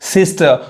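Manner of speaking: सिस्टर ending "-tuh", no r; silent r. Shown as a demonstration. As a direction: drop the final r of सिस्टर. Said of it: "आर नहीं पढ़ाता है".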